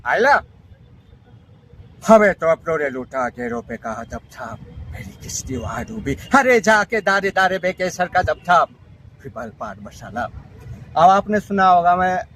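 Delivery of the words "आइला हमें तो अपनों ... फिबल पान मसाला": a hint of an old film singer; lightly voiced singer parody. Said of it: "unique voice".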